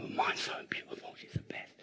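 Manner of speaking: soft breathy whisper about his granddaughter; a proud grandfather whispering fondly. Real, not acted. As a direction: hush it as a tender aside. Tender aside about granddaughter.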